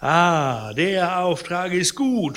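loud, drawn-out mock hilarity; wild laughter, acted. A narrator's reading. Sick man derides the errand given his visitor, loud mock mirth. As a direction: scoff loudly as if amused, slowly. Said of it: "fuhr er unter tollem Gelächter fort".

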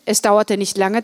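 usual speaking voice

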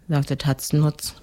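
ordinary delivery

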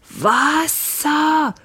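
high, strangled cry; choking gasp. A narrator's reading. Captain burning from hot goulash rasps for water, high choking cry. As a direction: croak it out high and drawn out. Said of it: "röchelte er".

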